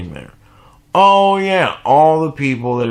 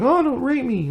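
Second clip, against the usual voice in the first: much higher mock-scared squeal; mock frightened lady; 13 semitones higher than usual. Mocking a frightened lady, in a high mock squeal.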